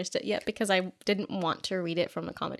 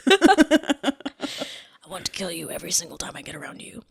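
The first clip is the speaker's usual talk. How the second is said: whispered mock vampire; 6 dB louder than usual.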